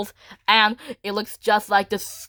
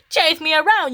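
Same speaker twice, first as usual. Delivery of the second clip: higher-pitched mild taunt; taunting villain; 8 semitones higher, 4 dB louder than usual. Acted. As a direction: lightly act a villain's challenge. Acting out Scout's taunt.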